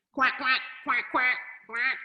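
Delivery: quick duck quacks; duck quacking. A narrator's reading. Reader voicing the duck for a child, quacking. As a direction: quack like a duck in short bursts. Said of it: "called the duck".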